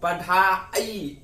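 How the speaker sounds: पढ़ाई said very slowly, the ending आई drawn out; teacher stretching पढ़ाई to show its suffix; slow syllable demo. Shown as a demonstration. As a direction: sound out पढ़ाई slowly so the आई stands out. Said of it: "पढ़ाई यानी आई".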